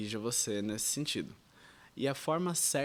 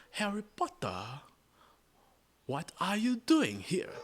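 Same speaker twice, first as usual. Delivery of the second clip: slow, high British accent; 8 semitones higher, slower than usual. Shown as a demonstration. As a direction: ask it like a Hogwarts teacher, British. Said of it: "um sotaque britânico". (sotaque britânico lento e agudo)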